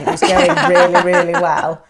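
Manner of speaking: laughing through the words. Real, not acted. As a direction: giggle as you say it. Laughing while telling it.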